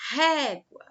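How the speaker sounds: régua with a loud, high ré; loud RÉ in régua, ending let fall; stress on first syllable. Shown as a demonstration. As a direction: punch the ré in régua. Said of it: "ré é a sílaba mais forte".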